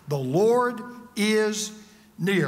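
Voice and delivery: slow, firm declaration word by word; out loud. Shown as a demonstration. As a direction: proclaim it slowly with weight on each word. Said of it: "learn to say them out loud".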